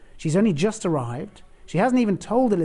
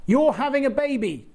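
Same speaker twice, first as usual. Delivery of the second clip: high, loud schoolboy announcement; child actor; 10 semitones higher, 5 dB louder than usual. Retold or imitated. Boy angel blurting news to Mary. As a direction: blurt it like a kid in a play.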